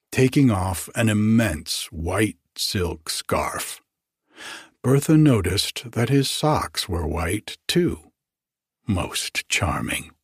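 plain reading voice

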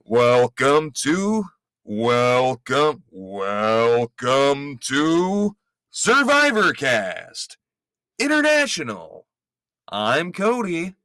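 slow, drawn-out game-show announcer; hammed-up reality show host welcome; big show host intro. Shown as a demonstration. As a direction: stretch every word like a TV host opening the show. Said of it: "That's better".

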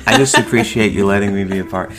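quick teasing imitation of his wife's politeness; a light, hammed-up prim guest; mock proper. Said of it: "so proper".

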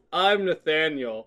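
ordinary talk

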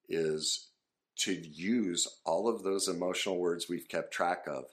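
ordinary talk

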